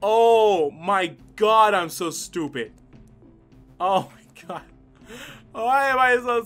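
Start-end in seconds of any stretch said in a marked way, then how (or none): none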